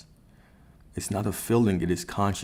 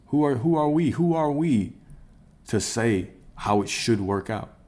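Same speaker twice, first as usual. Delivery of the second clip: higher, louder rhetorical challenge; who are we to judge, raised up; rhetorical outcry; 4 semitones higher, 3 dB louder than usual. Real, not acted. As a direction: throw the question up high.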